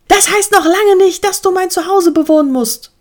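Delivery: high, loud, furious retort; angry snapping. A narrator's reading. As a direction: snap back at the newcomer, loud and high. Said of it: "fauchte Adi".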